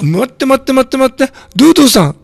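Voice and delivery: 高く弾んだ声で、待ってを畳みかける; 常連に気づいて声が跳ね上がる; 慌てて興奮. Real, not acted. (驚きまじりに慌てて歓迎)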